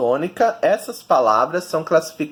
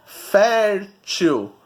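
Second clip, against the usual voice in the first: "Fértil" pulled apart, long FÉR then til; teacher sounding out "Fértil" by syllables; stress on FÉR; 2 semitones higher than usual. Shown as a demonstration. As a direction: stretch the first syllable of "Fértil". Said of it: "O fer é a sílaba tônica".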